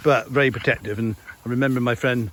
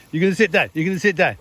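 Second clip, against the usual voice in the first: dog command; 6 semitones higher, 4 dB louder, faster than usual. Real, not acted. Telling the dog to sit.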